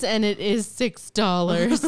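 loud, slow price complaint; mock protest. Telling friends about buying pricey vinegar, mock protest. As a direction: draw out the mock objection loudly. Quoting own reluctant splurge while amused.

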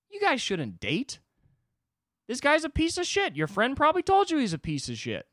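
high, loud, incredulous rant; exasperated mock tirade. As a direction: tear into them in comic outrage. Imagined tirade at the three actors.

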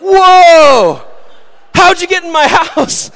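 very high, loud shocked yelp; startled shout. Pretend. Teen finds crush in house, shrieks high and loud. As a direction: jump out of your skin.

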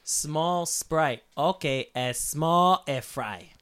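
very slow, each word laid out; slow, spelled-out order. Retold or imitated. Customer correcting the drive-thru lady, very slow. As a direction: say it slowly so she gets it.

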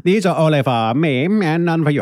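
a hint of a smug rich tycoon; Monopoly man voice. Voicing greedy Monopoly man.

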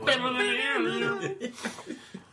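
high-pitched laughing fit. Real, not acted. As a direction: burst out laughing. Laughing at halfling question.

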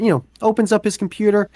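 normal talking voice

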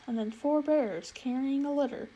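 plain reading voice

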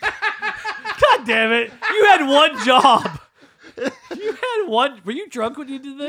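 high, slow, laughing yell; mock scolding. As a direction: holler it in hammed-up exasperation.

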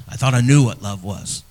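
usual speaking voice

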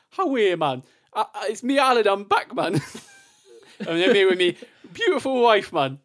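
high, loud Geordie Alan Shearer impression; big Geordie entrance, hammed up; put-on Geordie footballer voice; Geordie accent. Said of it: "do a Geordie accent".

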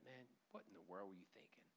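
hushed whisper at your reflection; whispered self-scolding. Acted. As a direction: whisper it to yourself, ashamed.

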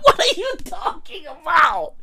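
very high-pitched comic disbelief; incredulous outburst. Acted. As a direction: squeal it in exaggerated bafflement. Acted disbelieving reply in retelling.

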